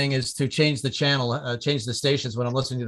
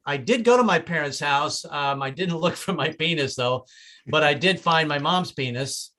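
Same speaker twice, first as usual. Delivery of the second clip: normal talking voice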